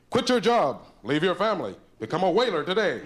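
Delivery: hammed-up matchbook ad voice; mock ad read. Reading matchbook ad.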